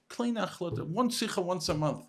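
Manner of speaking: small, mocking quote; belittling mimicry. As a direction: imitate piddling counsel with disdain. Mimics the mentor's tiny advice.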